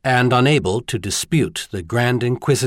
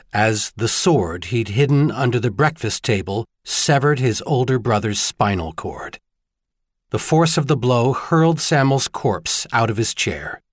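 plain reading voice

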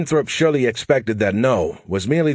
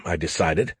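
ordinary delivery

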